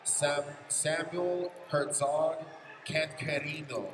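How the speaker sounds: ordinary talk, nothing marked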